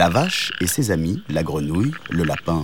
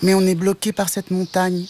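high-pitched cat voice; small kitten; 11 semitones higher than usual. A narrator's reading. The cat explains their blocked road to the mantis, high-pitched. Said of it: "répondit le chat".

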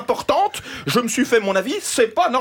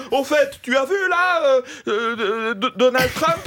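much higher, hammed-up stammering chatter; mock public chatter; 7 semitones higher than usual. Mock passenger fumbling small talk about Donald Trump. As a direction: mimic a stranger stumbling through small talk. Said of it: "des conversations publiques comme".

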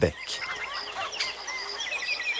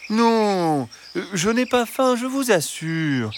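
high, sweet fox voice; reassuring fox; 11 semitones higher than usual. A narrator's reading. Fox assures the hens he isn't hungry, reassuring. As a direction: soothe them in a high, hesitant voice.

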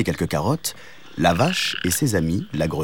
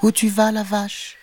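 little kitten voice; 13 semitones higher than usual. A narrator's reading. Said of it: "demanda-t-il".

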